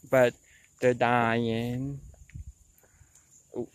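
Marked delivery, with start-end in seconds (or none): none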